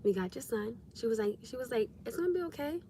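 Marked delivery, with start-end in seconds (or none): 0.0-0.8 soft-spoken, kind reassurance; hushed, understanding, easy voice; very calm tone. Retold or imitated. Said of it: "very calm tone". 2.1-2.8 softly imitated reassurance, lifted in pitch; kind, soft-spoken comforting; very calm tone. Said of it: "very calm tone".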